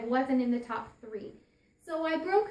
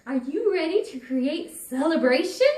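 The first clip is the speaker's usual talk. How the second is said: louder, upbeat call into the room; celebratory invitation; 3 semitones higher, 8 dB louder than usual. Retold or imitated. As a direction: invite her to celebrate with bright energy. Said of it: "I'm exuding this celebration".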